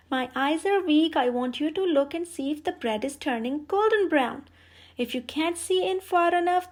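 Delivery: high, loud scheming witch; witch calling out. A narrator's reading. Witch calls Gretel to the oven, high and loud. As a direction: call her over, sly and pushy. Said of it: "called out to Gretel".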